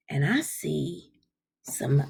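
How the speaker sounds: hushed, slow whisper. Acted. Teacher teasing the class about a surprise, whispering. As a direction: lean in and breathe it secretly.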